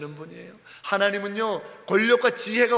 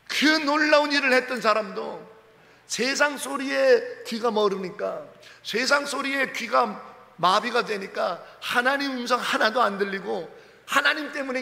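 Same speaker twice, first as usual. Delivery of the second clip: forceful preaching; 4 semitones higher, 4 dB louder than usual. Real, not acted. Preacher to congregation, building to full force.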